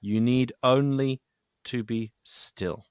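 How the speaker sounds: measured, solemn reading of the verse; slow scripture reading. Acted. Host reads scripture verse aloud.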